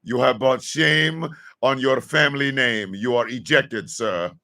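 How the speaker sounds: a half-mocking formal banishment speech; formal ejection decree. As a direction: pronounce the sentence like a court herald, gravely. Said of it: "we should say something like".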